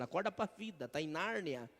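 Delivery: ordinary talk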